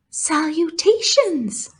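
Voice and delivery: slow, high, elegant greeting; thin spider voice. Acted. Charlotte greeting Wilbur, thin graceful voice. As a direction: savor the fancy word.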